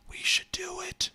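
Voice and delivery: high breathy whisper; soft unvoiced whisper. Real, not acted. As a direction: whisper the invitation slyly. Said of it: "playful invite".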